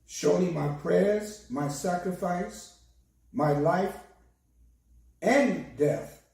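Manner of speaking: usual speaking voice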